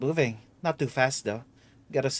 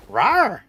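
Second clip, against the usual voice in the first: loud, playful dinosaur roar; 6 semitones higher, 10 dB louder than usual. Pretend. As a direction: roar out like a little saurian.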